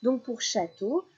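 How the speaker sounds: ordinary delivery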